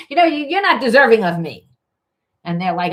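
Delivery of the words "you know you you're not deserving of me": higher, louder, quick and condescending; scornful put-down. Retold or imitated. Partner telling the other they're unworthy, scornful and quick. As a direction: look down on them fast.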